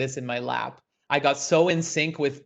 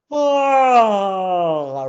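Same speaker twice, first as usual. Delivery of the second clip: big, loud yawn; long yawn sliding down from high; demonstrated yawn; 4 semitones higher, 7 dB louder than usual. Shown as a demonstration. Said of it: "Even do a yawn".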